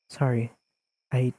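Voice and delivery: usual speaking voice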